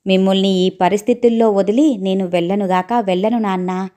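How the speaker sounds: ordinary delivery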